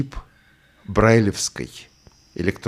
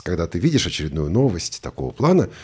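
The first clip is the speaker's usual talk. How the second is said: usual speaking voice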